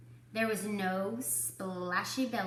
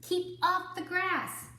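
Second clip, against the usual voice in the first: much higher and slow, like a fussy sign; 10 semitones higher, slower than usual. Acted. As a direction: read the sign high and drawn out. Sign read in a voice.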